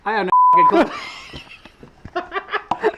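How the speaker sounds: astonished outburst. Real, not acted. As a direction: blurt out stunned. Amazed at own win.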